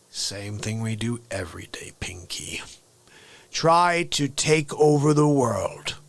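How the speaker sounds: hushed, low mastermind mouse, then slow and grand; the Brain. Acted. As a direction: murmur it deep, then swell on the big plan. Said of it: "I'm gonna do an impression".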